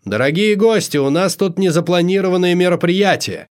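high, projected host's announcement; announcing. A narrator's reading. Host telling guests of a hide-and-seek game, announcing. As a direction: address the whole party like a showman. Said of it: "объявил Амадей".

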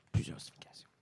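whispered aside; sly stage whisper. Shown as a demonstration. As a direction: breathe it as a secret. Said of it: "Ça a plusieurs significations".

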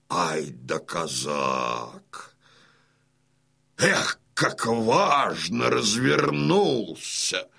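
slow, savouring each word; slow admiring drawl. Acted. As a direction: marvel at him slowly. Admiring the sprawled cossack. (медленное любование)

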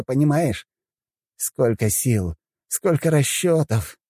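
high, fussy mage scolding, slowing at the end; exasperated old mage. Acted. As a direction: voice an exasperated wizard lecturing. Giovanni lecturing Mira.

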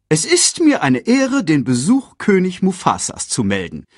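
high, officious herald voice; herald's announcement. A narrator's reading. Sasu reports the king's visit to Scar, high formal announcement.

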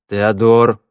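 loud, stern warning; menacing. A narrator's reading. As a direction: say the name as a threat. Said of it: "грозно сказал он". (грозно)

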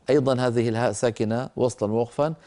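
ordinary delivery

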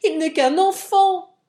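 high, loud protest; high-pitched indignant shout; exclaiming. A narrator's reading. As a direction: cry out to defend the boy. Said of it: "s'écria la jeune fille".

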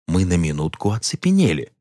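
plain reading voice

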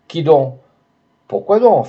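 alarmed questions. A narrator's reading. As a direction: Esther questions the men at the door, alarmed.

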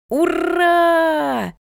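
long, high, loud hurrah; joyful shout. A narrator's reading. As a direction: cheer at full voice like a delighted boy. Child cheers, given an apple.

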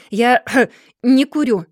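slow, loud, choked-off outburst; furious shout. A narrator's reading. Mr. Dorrit refuses John's gift, shouting in rage. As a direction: clear the throat, then thunder it. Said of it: "с неудержимым бешенством".

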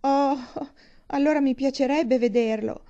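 high, loud gasp of delight; delighted longing. Acted. Catherine wishing to see the castle, delighted. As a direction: exclaim with eager longing. Heroine thrilled about the castle.

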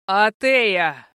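loud, drawn-out furious yell; enraged roar. A narrator's reading. Furious prince losing the fugitive, enraged roar. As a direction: roar her name in a rage. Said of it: "рычал в бешенстве князь моё имя".

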